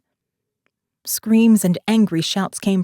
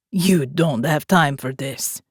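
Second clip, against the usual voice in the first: sneering; 4 semitones lower, slower than usual. A narrator's reading. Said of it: "he sneered at her".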